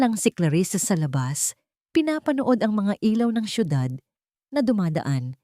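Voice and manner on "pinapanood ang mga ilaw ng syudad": ordinary delivery, nothing marked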